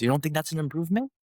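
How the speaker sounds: rapid, high-pitched coach challenge; imitating coach. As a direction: hammer home the point like a coach. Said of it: "And he goes".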